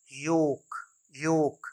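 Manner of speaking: योक said "yoke"; long O straight into K in योक; silent L. Shown as a demonstration. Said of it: "एल का sound नहीं है". (योक में एल चुप)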